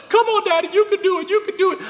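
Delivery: put-on very high-pitched child cheer; kids cheering. Kids cheering their dad on the trampoline, excited. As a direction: pipe up like excited kids egging on their father. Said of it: "they're all cheering".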